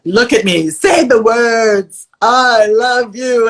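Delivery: imitating a pushy friend, drawn out; insistent friend. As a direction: demand the words slowly, face to face. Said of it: "She's like".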